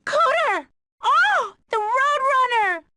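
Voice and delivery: delighted squeal. Acted. Surprise car gift.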